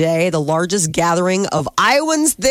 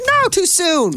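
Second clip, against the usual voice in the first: mock dismay; 10 semitones higher, slower than usual. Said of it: "I was like".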